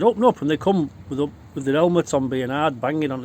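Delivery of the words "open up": police demand. Retold or imitated. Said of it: "And they said".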